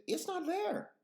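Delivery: high-pitched mock freak-out; shocked disbelief. Telling how the spider vanished, high and shocked. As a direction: squeak it out in dramatic disbelief.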